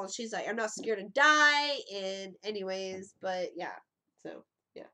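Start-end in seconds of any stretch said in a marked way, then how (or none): 0.5-1.8 put-on slow, loud drunken boast; slow drunk bravado. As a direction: drawl it out bravely. Drunk friend voiced slowly.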